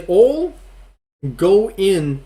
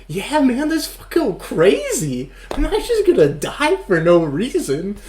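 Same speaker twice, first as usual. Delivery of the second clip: mock high-pitched goon backing out; hammed-up whiny high voice; scared goon; 9 semitones higher, slower than usual. Dude backs out of heist.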